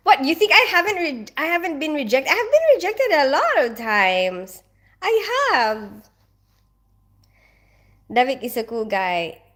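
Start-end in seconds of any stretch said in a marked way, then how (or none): 0.1-6.1 high-pitched, incredulous protest; worked-up, high indignant insistence. Real, not acted. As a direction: protest it high, stretching the last words. Protests at being thought never rejected.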